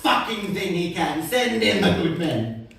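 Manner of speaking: furious tirade. Acted. Poet raging at the government over war spending, loud and furious. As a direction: spit the words out at the government.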